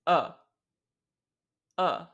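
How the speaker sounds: "Uh" as a neutral mid schwa; "Uh" said short and lax; schwa demo. Shown as a demonstration. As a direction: say "Uh" with a barely open jaw.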